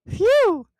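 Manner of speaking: high loud relieved exhale; exaggerated phew of relief. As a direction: blow out a big phew of relief. Phoenix arriving breathless.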